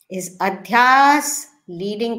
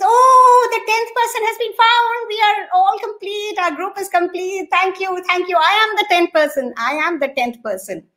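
much higher, joyful relief at being complete; 9 semitones higher than usual. Acted. Friend realises he is the tenth man, high joyful relief. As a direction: burst out happy, the group is whole.

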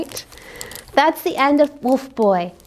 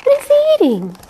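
surprised; 13 semitones higher, 4 dB louder, much faster than usual. Real, not acted. Narrator asks children.

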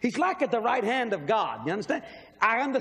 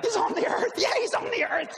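much higher, sing-song mock repeat; high mock echo of the angel; 11 semitones higher than usual. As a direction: parrot the answer back in a high silly voice. Mocking himself, replaying answer.

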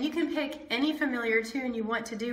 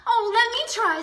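eager offer; 10 semitones higher, 6 dB louder than usual. Acted. Sister eager to help.

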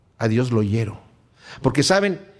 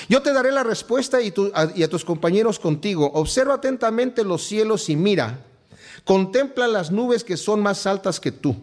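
lectura bíblica solemne; 4 semitones higher than usual. Acted. Reads scripture aloud, raised. (lectura solemne y pausada de las Escrituras)

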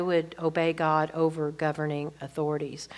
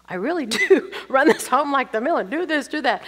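laughing at herself; 6 semitones higher, 4 dB louder than usual. Retold or imitated.

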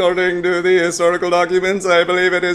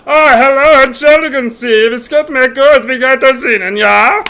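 loud, higher German greeting; German accent practice; 6 semitones higher, 5 dB louder than usual. Pretend. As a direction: try out an over-eager German accent. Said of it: "practice my range of accents".

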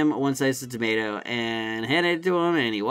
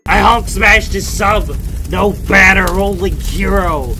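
smash it like the Hulk; mock Hulk; 4 semitones higher, 8 dB louder than usual. Worker hamming up a Hulk smash on the sub, loud.

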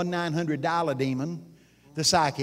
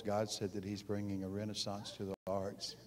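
lower, softer, dull and unexcited; monotone prophecy; 7 semitones lower, 11 dB quieter than usual. Retold or imitated. Preacher recalls reciting God's word in a flat monotone. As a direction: say it like a chore, no life in it. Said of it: "I'd prophesied in a monotone voice".

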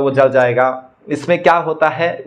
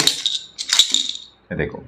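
whisper; 9 semitones lower, 6 dB quieter, much slower than usual. Pretend. Secret reveal to viewers.

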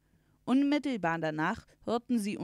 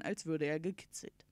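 usual speaking voice

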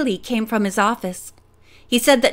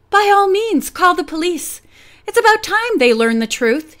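scornful; 6 semitones higher, 3 dB louder than usual. A narrator's reading. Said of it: "with a scornful glance".

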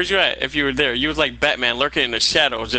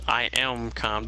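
forced calm; 4 semitones lower, 6 dB quieter, slower than usual. Real, not acted. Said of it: "Do I not sound calm to you?".

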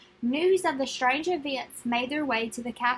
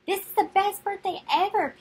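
put-on bright, high, louder thrill of a birthday boy; delighted boy; 6 semitones higher, 5 dB louder than usual. Boy thrilled by his puppy, excited. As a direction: burst out with joy at the puppy.

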